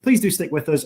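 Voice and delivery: normal talking voice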